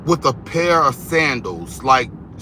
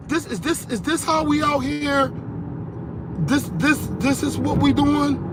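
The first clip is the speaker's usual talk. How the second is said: much higher, incredulous mom voice; mom's shocked reaction; 12 semitones higher than usual. Retold or imitated. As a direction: play the stunned mom who can't believe it.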